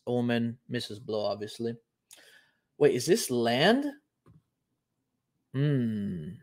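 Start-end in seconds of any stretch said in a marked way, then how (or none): none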